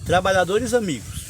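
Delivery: loud, ringing recital of the opening line; declaiming cordel verse. Shown as a demonstration. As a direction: proclaim it like a poet on a stage. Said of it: "a primeira você bota um A".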